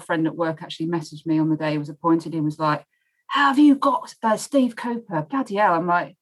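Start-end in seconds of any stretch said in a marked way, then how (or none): 3.3-5.7 hammed-up Cockney mate; comedy Cockney accent. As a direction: put on a comic Cockney voice. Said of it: "my, my comedy Cockney accent".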